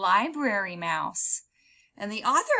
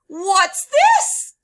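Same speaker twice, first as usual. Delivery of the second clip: very loud high "What's this?"; a teenager stumbling on a creepy book; startled discovery; curious question; 9 semitones higher, 13 dB louder than usual. Acted.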